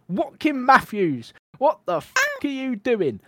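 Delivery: mock-outraged holler, pitched well up; yelled. A narrator's reading. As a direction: read it as an outraged wife yelling at her husband. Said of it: "I yelled".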